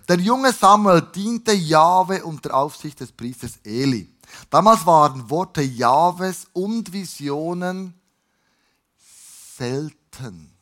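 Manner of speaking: slow, emphatic story narration; scripture reading. A narrator's reading. Preacher reads the Samuel story to the congregation, slow scripture reading. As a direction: read it out deliberately, weighting the words.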